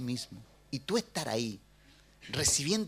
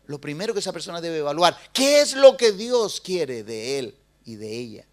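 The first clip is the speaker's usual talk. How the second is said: ordinary talk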